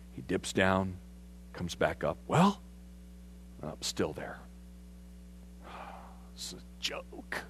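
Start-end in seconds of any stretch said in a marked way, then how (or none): 2.3-2.6 eager servant. Retold or imitated. Servants asking after dip. 3.6-7.4 soft, sighing, breathy disbelief; deflated, barely above a whisper; dejected Naaman. Retold or imitated. Naaman disappointed after dipping.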